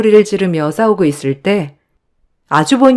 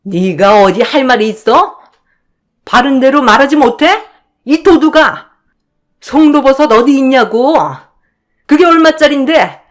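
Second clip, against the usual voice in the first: shouting; 6 semitones higher than usual. Acted. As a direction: scream at the thief. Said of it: "제가 소리를 고래고래 지르자".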